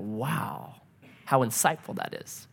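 soft, low thought to oneself; retold hushed realization; quiet awe. Counselor struck by wife's answer, quietly impressed.

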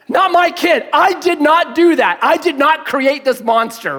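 high, loud mock protest; hammed-up emphatic denial; loud disowning. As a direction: proclaim innocence to the whole crowd. Imagined disowning before onlookers.

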